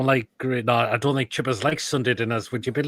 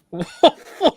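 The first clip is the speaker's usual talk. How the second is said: loud mock barking; 11 dB louder than usual. Barking again at the food.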